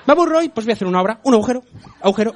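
high, loud caricature of a bored politician; hammed-up eagerness, speeding up into the obsession; Gallardón. Comic plays bored mayor planning holes, manic mock excitement. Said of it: "Gallardón, no se aburre".

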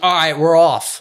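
mock-chipper driver setting off; imitating the driver. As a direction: chirp it like nothing is wrong. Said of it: "and she's like".

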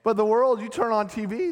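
ordinary talk, nothing marked

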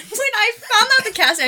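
ordinary delivery

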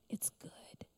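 very soft, breathy whisper; barely audible reassurance; God's whispered voice. Retold or imitated. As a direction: whisper it as God's gentle answer. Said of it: "I heard his voice for the first time".

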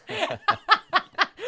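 loud high burst of laughter; big laugh. Real, not acted. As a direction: really crack up. Laughing at the joke.

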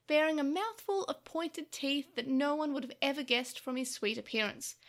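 ordinary delivery

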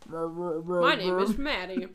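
talking through a mouthful; mouth full. Real, not acted. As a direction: say it round a mouthful. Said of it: "Mouthful of mac and cheese".

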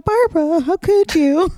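lightly hammed-up betrayal; mock betrayed plea. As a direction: play it up as a wounded friend. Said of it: "She's like".

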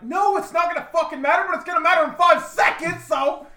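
loud, high-pitched angry rant; exasperated shouting. Retold or imitated. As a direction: yell back as if re-enacting a fight. Retold furious argument with Reed.

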